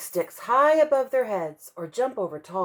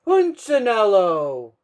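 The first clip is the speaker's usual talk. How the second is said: slow, loud, commanding call; the wood carver calling out, deep and strong; 7 dB louder, slower than usual. Acted. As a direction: boom his name across the workshop. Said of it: "The voice was deep and strong".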